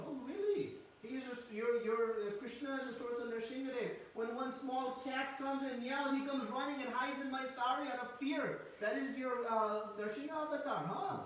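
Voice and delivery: high, teasing sarcastic retort; mock-scornful mother. Mother Yashoda ridiculing Balaram's boast, high teasing sarcasm. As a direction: tease him for his big claims.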